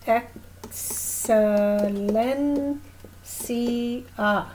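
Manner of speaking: excelencia drawn out slowly in Spanish; Spanish pronunciation. Shown as a demonstration. Teacher showing students excelencia, slow Spanish. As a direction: model excelencia slowly for the class. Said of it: "So it'd be like".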